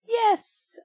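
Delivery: put-on a kid cheering his own winning shot; excited cry. A narrator's reading. Boy yells yes as his sister chases the ball, high cry. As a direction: jump up high on a gleeful yes. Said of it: "I cried".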